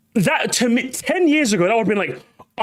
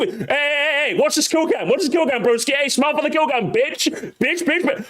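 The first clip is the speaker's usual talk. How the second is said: put-on high, taunting gamer-kid voice; rapid-fire high-pitched heckling; bratty teenage troll; 7 semitones higher than usual. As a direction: goad them like an obnoxious kid. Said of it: "little shit with a tiny little Bluetooth headphone".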